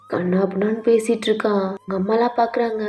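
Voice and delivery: ordinary delivery, nothing marked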